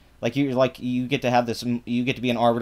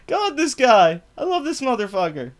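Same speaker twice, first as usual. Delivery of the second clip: laughing exasperation; 9 semitones higher than usual. Real, not acted. Laugh-cry at the tweets.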